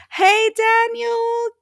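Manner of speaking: high, loud, drawn-out hello; excited greeting. Real, not acted. Host hails a friend joining the stream, excited. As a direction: call out a delighted greeting, stretching the name. Greeting an arriving viewer by name.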